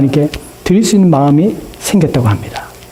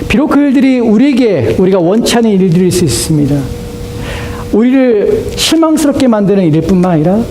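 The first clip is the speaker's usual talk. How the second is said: preaching at full force; 11 semitones higher than usual. Acted. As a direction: build steadily into a high, ringing proclamation. (힘주어 높이 외치는 설교)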